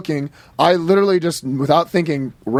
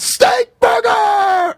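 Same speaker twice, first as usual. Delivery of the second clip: slow squeaky scream, much higher; much slower than usual. Retold or imitated. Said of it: "He just screamed at the top of his lungs".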